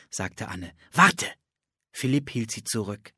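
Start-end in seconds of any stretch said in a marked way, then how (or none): 0.9-1.3 urgent call. Acted. Philipp stops Anne abruptly.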